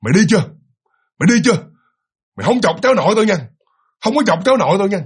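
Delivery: shouting woman's scolding voice; loud old woman's scold. Acted. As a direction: bark it at the intruder like an old woman. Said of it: "nạt rất là lớn nha".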